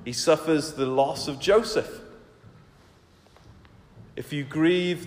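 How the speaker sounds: normal talking voice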